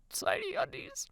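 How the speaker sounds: high, hushed squeak; horrified whisper. A narrator's reading. Girl after clothes rant, horrified whisper. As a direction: repeat it in a tiny whispered voice.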